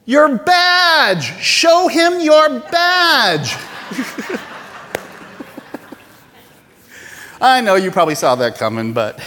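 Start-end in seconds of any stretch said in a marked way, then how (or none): 0.0-3.6 high, loud, drawn-out yell; shouting across field. Retold or imitated. Rancher yells to the fleeing agent, a loud drawn-out shout. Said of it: "leans over the fence and says". 3.6-5.9 laugh. Real, not acted. After the punchline.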